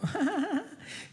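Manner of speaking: high, nervous little laugh; awkward high giggle. Retold or imitated. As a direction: giggle uneasily. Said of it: "They go".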